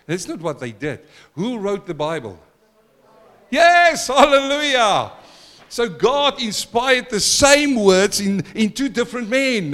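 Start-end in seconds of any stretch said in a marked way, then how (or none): 3.5-9.7 high, loud hallelujah burst; exultant preacher shout; joyful shout. Real, not acted. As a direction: cry out in praise. Exclaims hallelujah.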